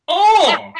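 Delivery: loud, high "oh" bursting into laughter; shocked cry. Real, not acted. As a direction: blurt out a loud, appalled yelp. Shocked at image search results.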